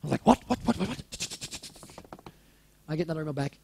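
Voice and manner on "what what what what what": rapid-fire stunned repeats, dying to a whisper; acted-out speechless astonishment; stunned disbelief. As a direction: stammer it in mock shock. Acts out stunned reaction to email.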